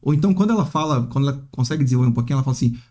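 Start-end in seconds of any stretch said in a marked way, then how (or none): none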